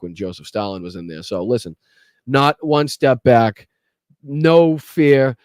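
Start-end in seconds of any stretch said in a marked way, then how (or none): 2.3-5.4 slow, punched-out slogan; rallying cry. Real, not acted. Host closing with a rallying cry to listen to, slow and forceful. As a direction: land each word hard and slowly.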